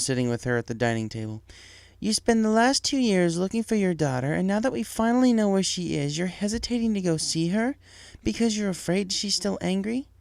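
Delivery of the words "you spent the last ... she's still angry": higher mother voice, pointed and doubtful; Firefly, skeptical. Acted. Wife questioning her husband, skeptical. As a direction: question him like a disbelieving wife.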